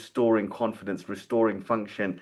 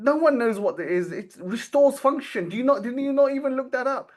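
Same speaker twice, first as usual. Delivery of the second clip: mock angry scolding; 12 semitones higher, 3 dB louder than usual. Retelling the dentist yelling down the phone at her, mock furious. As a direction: act out the client berating her, pitched up and loud. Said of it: "he just went on and on".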